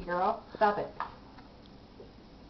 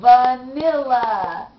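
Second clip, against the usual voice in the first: slow and loud; 11 dB louder, much slower than usual. Shown as a demonstration. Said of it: "what's that word?".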